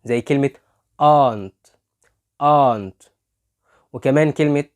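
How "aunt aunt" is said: "aunt" as British "aahnt"; AU as long AH. Shown as a demonstration. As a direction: model "aunt" loudly with a held "ah". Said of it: "ممكن كمان ال A U".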